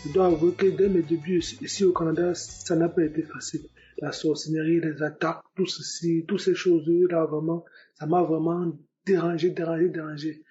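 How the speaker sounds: ordinary talk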